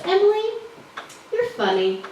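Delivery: a hint of an aunt, pitch dropping after the name; lightly voiced great aunt. Great aunt sizing up the comedian, lightly voiced great aunt.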